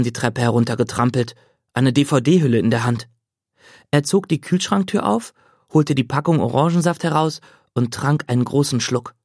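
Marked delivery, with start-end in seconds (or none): none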